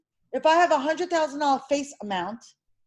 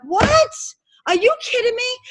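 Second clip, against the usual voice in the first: much higher and louder, shocked; stunned disbelief; 11 semitones higher, 4 dB louder than usual. Retold or imitated. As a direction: squeak out your disbelief. Teacher retells her disbelief.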